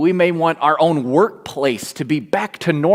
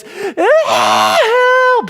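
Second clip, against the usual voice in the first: put-on high, loud child's scream for help; 5 dB louder than usual. Hurt daughter screaming to her parent, a loud high yell. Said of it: "the cry that screams and yells".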